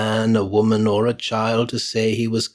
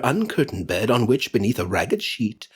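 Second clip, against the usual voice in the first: plain reading voice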